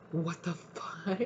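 slow, hushed, drawn-out shock; stunned disbelief. Acted. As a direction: let it out softly, as if dumbfounded. Acted shock at friend's betrayal.

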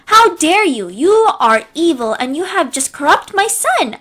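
high, loud furious accusation; furious outburst. Acted. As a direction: explode in outrage at her. Karen furious at narrator.

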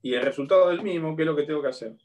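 usual speaking voice

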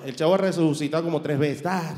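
ordinary talk

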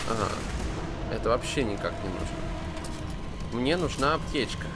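usual speaking voice

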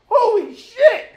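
high, drawn-out yell of alarm; alarmed shout. Retold or imitated. Friend reliving a car speeding at him, alarmed shout. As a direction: holler it in hammed-up terror.

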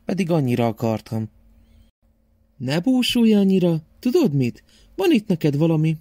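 high, caring grandmother voice; loving grandma. Acted. Grandma comforts sad Szaszi, high loving voice.

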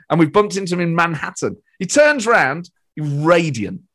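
ordinary talk

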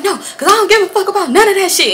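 high-pitched indignant retort; mock defiance. Sister brushes off his excuses, high-pitched mock defiance. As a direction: act out a sister refusing to back down.

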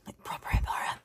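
whispered, like sharing a secret; stage whisper. Acted. Whispering a secret about YouTube to her viewers. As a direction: lean in and whisper it. Confiding important news to viewers.

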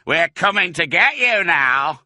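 high, loud threat; taunting full-voiced holler; yelled. A narrator's reading. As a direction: yell it down at the hunted fox. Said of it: "yelled Bunce".

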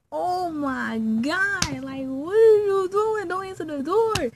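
high, loud, exaggerated alarm; mock panicked outcry. Storyteller replaying panic at friend by crush's door, high and loud. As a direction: cry out in hammed-up panic.

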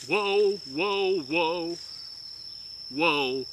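usual speaking voice

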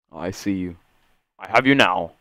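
normal talking voice

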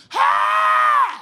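long, high hollered call; loud yell. Retold or imitated. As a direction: holler it high across the cell block. Inmate yelling from his cell.

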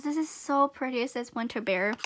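ordinary delivery

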